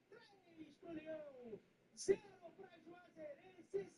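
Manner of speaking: slow, drawn-out goal narration; explosive goal commentary. Acted. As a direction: stretch the score out like a radio call. Calling the goal and score.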